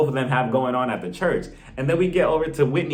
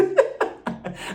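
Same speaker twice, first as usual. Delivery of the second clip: airy silent laugh, no voice; breathy laugh. Real, not acted. Laughing at her own roast.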